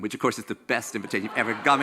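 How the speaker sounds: usual speaking voice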